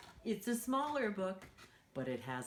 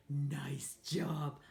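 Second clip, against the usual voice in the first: whisper; 4 semitones lower than usual. Real, not acted. Praising children softly.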